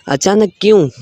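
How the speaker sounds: ordinary delivery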